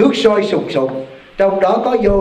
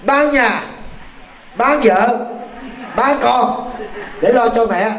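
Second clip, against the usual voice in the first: emphatic quote; 5 semitones higher, much slower than usual. Retold or imitated. Quoting the child's bold answer.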